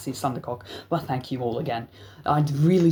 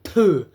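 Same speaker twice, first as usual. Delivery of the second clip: spitting sound; 5 semitones higher, 7 dB louder than usual. Retold or imitated. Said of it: "as if spitting on us".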